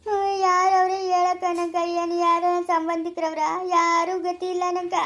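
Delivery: crying, words stretched out; tearful lament. Acted. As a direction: lament helplessly through tears. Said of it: "ಅಳ್ಬೇಡ ಸುಮ್ನಿರು".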